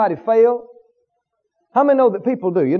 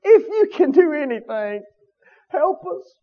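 a touch of quiet pleading, softer; 4 semitones higher, 4 dB quieter than usual. Retold or imitated. Father begging Jesus to help his son, mildly pleading. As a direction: beg gently for help. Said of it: "probably in a pleading tone says".

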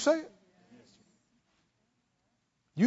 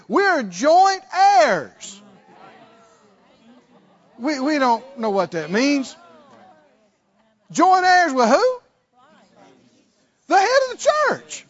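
high, loud preacher's cry; full-force preaching; 7 semitones higher, 7 dB louder than usual. Acted. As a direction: preach it out over the crowd.

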